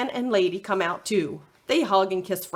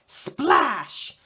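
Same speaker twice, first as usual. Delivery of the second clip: usual speaking voice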